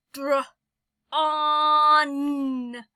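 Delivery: dr, then a loud drawn-out on; teacher segmenting dr-awn; sounded-out word. Shown as a demonstration. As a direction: split the word and boom the ending. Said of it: "Sometimes you have an N".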